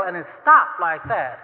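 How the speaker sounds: ordinary delivery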